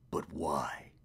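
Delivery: low unvoiced whispered question. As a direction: breathe it as a whisper. Whispered character line.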